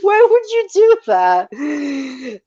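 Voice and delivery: high, slow, fake-sobbing whine; mock crying. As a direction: pretend to cry while laughing. Teasing friend about sad tradition.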